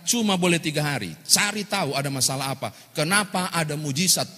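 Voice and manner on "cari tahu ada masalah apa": ordinary talk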